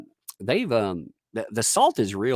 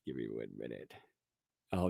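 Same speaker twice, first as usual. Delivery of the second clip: ordinary talk, nothing marked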